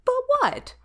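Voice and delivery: sharp high indignant pitch jump; indignant but what. Acted. AI snaps at hesitation.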